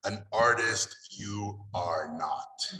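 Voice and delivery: like Yoda. Retold or imitated. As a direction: drawl it like Yoda with a Hungarian accent. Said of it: "like Yoda, he said it".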